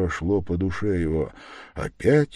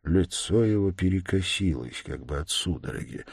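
plain reading voice

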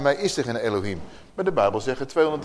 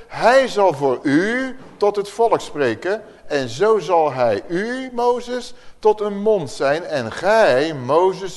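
slow, louder and higher, every word weighed; solemn scripture reading; 4 semitones higher, 5 dB louder, slower than usual. Acted. Preacher reading Exodus to his Bible study group, slow solemn proclamation. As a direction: proclaim the verse like a preacher from the pulpit. Reads Exodus quote to listeners.

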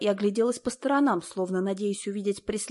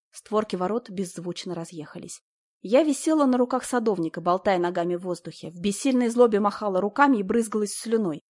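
usual speaking voice

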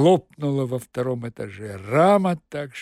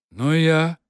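unfriendly; 4 dB louder than usual. Acted. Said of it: "неприязненно ответил".

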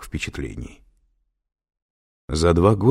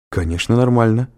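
puzzled reply, pitched up; a touch of bewilderment, raised voice; 6 semitones higher, 5 dB louder than usual. A narrator's reading. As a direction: answer as if baffled by the question. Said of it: "озадаченно проговорила Кэтрин".

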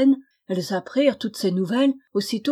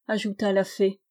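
usual speaking voice

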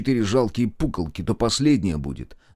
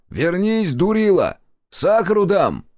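high, urgent calling out; shouting after someone; 7 semitones higher, 3 dB louder than usual. A narrator's reading. Zotov calls the runaway fool back from danger, shouting. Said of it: "прокричал".

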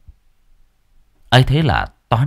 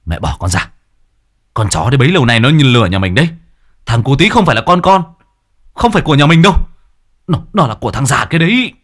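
furious yelling husband; 4 semitones higher, 5 dB louder, faster than usual. Acted. Husband yelling at his mother about the cheating wife, furious shout. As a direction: shout it out, loud and fast, betrayed.

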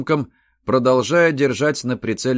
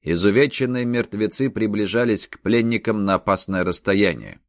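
usual speaking voice

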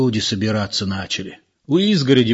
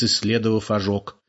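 ordinary delivery, nothing marked

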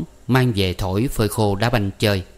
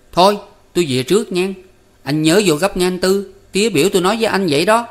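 put-on higher, fast hurried kid; boy Năm; 10 semitones higher, 4 dB louder, faster than usual. A narrator's reading. Năm hurrying off from Tư Cầu, fast higher boy voice. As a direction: rush the words, eager to leave.

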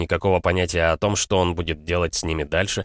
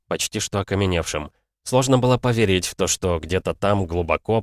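usual speaking voice

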